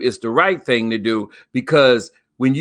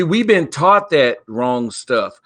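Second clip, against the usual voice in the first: usual speaking voice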